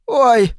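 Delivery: high sharp yelp of pain. A narrator's reading. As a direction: cry out as the blow lands. Struck in face. (вскрик боли)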